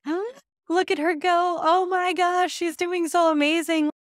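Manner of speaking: high, loud gushing cheer; excited cheering. Retold or imitated. Proud helper watching a kid in the show ring, excited cheering. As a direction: squeal with pride, drawing out the last words. Acting herself cheering at ringside.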